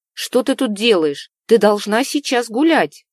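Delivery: scolding. A narrator's reading. Husband scolds the runaway princess.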